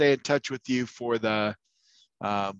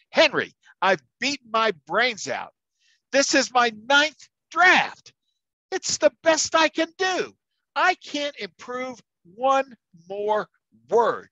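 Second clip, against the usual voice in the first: high-pitched, straining, fed-up cry; retold furious outburst; 11 semitones higher, 4 dB louder than usual. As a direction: let the voice climb higher and higher in exasperated fury. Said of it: "He's fed up and he is furious".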